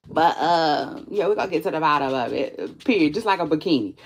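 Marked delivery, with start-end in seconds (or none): none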